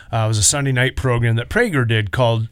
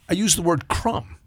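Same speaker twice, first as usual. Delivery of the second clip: ordinary talk, nothing marked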